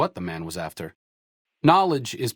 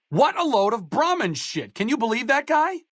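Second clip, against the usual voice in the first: outraged scoff; 13 semitones higher than usual. Acted. Companion scoffing at a stranger's nonsense, outraged.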